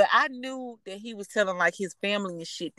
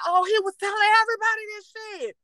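much higher and fast, outraged disbelief; shocked realisation; 13 semitones higher, faster than usual. Retold or imitated. Finding his messages to another girl, high fast outraged disbelief. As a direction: blurt it out, stunned and high.

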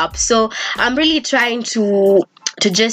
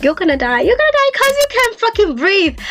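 high, panicked cry of doom; frantic high voice of panic; panicked inner voice; 11 semitones higher than usual. Retold or imitated. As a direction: shriek it like you're sure you'll die. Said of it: "I was getting so frustrated".

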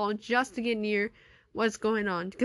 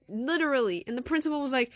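ordinary delivery

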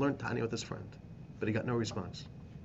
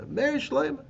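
much higher calling out the name; retold call through a window; 4 dB louder, slower than usual. Sick man recalling his friend calling through the window, higher call. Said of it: "I hear your voice screaming through the window".

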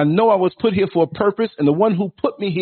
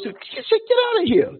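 incredulous. Real, not acted. Dismissing the foolishness.

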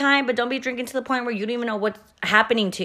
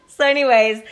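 laughing; 5 semitones higher, 7 dB louder than usual. Real, not acted. Laughing, moving on.

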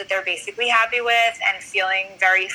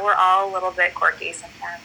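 normal talking voice

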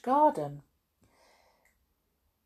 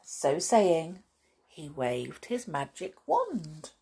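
usual speaking voice

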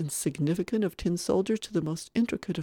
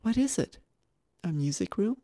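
higher-pitched wondering question; curious questioning; 4 semitones higher than usual. Acted. A guest asks companion about the room, curious. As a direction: ask it with rising wonder.

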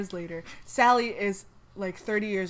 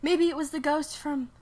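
Sally voice; 6 semitones higher, 4 dB louder than usual. Acted.

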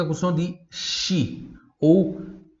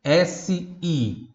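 letters S C U S I spoken slowly one by one; teacher spelling letter by letter; 2 semitones higher, much slower than usual. Shown as a demonstration. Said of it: "se escreve".

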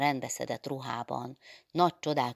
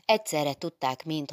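ordinary delivery, nothing marked

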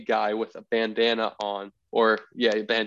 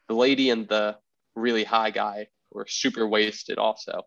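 ordinary talk, nothing marked

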